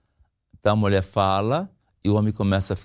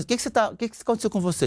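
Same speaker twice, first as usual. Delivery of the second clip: higher, hurried questioning, mildly irritated; irritated wife; 10 semitones higher, much faster than usual. Retold or imitated. Wife questions her silent husband, mildly irritated. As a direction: imitate a wife pressing for answers. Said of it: "a esposa se irrita".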